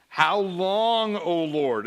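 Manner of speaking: a hint of a lamenting psalmist. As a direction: lean into the biblical how long. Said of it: "the biblically laden language".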